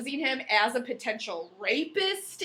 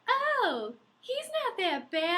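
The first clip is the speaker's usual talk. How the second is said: lightly voiced naive girl, higher and slow; mock naive heroine; 9 semitones higher, slower than usual. Mocking the heroine who excuses him, higher and slow. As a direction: shrug it off like a dreamy girl. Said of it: "she's like".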